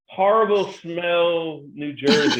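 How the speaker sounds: mock town slogan. Joking about New Jersey town names, slow mock slogan. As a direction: announce it like a proud town name, joking. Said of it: "It would be like".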